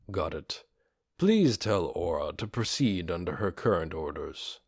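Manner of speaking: low, soft, measured voice of a skeletal ruler; a calm dark lord thanking his servant; undead lord voice. A narrator's reading. Ainz voiced in story.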